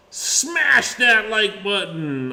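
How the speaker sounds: slow, drawn-out YouTuber plug; lightly mock the YouTube outro. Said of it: "I guess is what they say".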